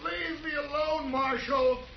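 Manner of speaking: high, strained plea to be left alone; cornered man begging the marshal to leave him be; frightened pleading. Acted. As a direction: beg him to keep away, scared stiff. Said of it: "That's a frightened man".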